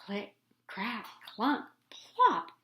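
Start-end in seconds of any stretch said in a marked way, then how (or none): none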